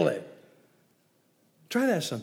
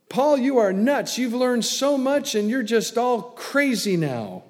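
higher, louder scoff; 7 semitones higher, 4 dB louder than usual. Acted. Festus yelling that Paul is crazy, loud and high. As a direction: shout him down as nuts.

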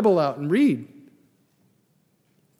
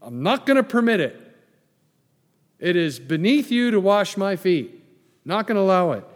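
higher, louder protest in Peter's voice; indignant Peter; 4 semitones higher, 6 dB louder than usual. Retold or imitated. Pastor voicing Peter refusing Jesus washing his feet, loud indignant protest. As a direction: refuse him, shocked he would stoop. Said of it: "Peter said to him".

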